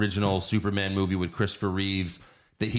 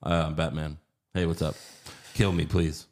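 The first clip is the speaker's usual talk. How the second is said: low, half-hearted Batman impression; mumbled mock hero, no effort at all; George Clooney; 6 semitones lower than usual. Comic mocking a Batman who'd rather quit, low and half-hearted. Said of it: "we had just George Clooney".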